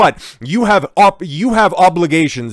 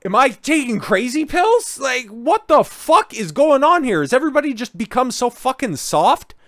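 higher, exasperated disbelief; incredulous rant; 10 semitones higher than usual. Real, not acted. Streamer ranting to viewers about critics, pitched up in disbelief.

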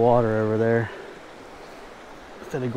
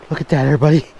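ordinary talk

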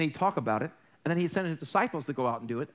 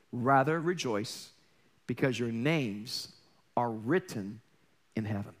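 solemn scripture reading; 7 semitones lower than usual. Acted. Solemn reading of Jesus' words.

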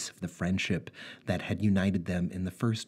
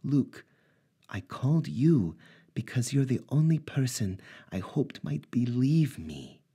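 higher woman's voice, close to tears; Natasha's voice, tearful; 7 semitones higher than usual. Acted. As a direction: play the shaken ex-wife, voice raised in pitch. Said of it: "Natasha said".

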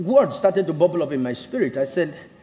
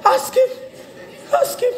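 agitated wife. Retold or imitated. Wife answers pastor about their sex life, high-pitched mock outburst. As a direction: squeal it out, imitating an exasperated wife. Acting wife's agitated retort.

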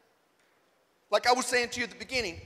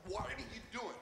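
hushed exasperation; 2 semitones lower, 16 dB quieter than usual. Retold or imitated. Retold reaction to line cutters.

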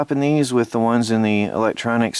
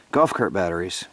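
ordinary delivery

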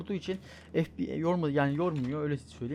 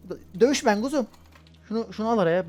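higher, louder urgent calls; urgent battle calls; excited; 6 semitones higher, 8 dB louder than usual. Real, not acted. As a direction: hype up in the fight. Fighting in game.

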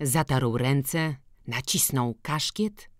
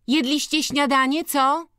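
ordinary delivery

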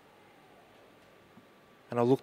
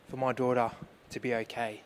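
ordinary delivery

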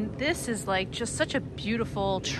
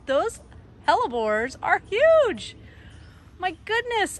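delighted surprise; 9 semitones higher, 4 dB louder than usual. Real, not acted. Gardener showing viewers her overgrown hellebores, delighted and excited. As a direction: pitch jumps high, the words drawn out in wonder.

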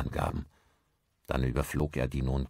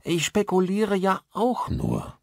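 much higher, drawn-out character voice; Maguire the installer, protesting; 4 dB louder, slower than usual. Acted. Installer echoes the father's guess, high drawn-out protest. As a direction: stretch it out high, dropping at the end.

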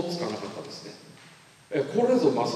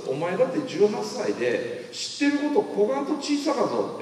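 high-pitched Kumamoto-dialect admonishment; 7 semitones higher than usual. Retold or imitated. Kumamoto mentor telling an eighteen-year-old how little he knows, Kumamoto dialect. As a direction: slip into the mentor's Kumamoto accent. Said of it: "熊本弁出てまうんで".

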